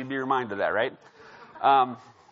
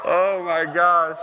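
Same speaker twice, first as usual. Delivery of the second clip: slow, louder words through real laughter; laughing; 2 semitones higher, 8 dB louder, slower than usual. Real, not acted. Laughing after punchline.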